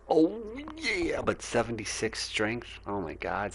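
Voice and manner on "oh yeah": much higher, silly cartoon voice; put-on high voice. Shown as a demonstration. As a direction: pitch it way up as a goofy character.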